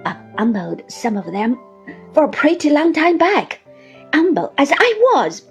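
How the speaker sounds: snarling. A narrator's reading. Uriah turns on the narrator about being humble, snarling. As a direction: boast it with a snarl. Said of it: "looking at me with a snarl".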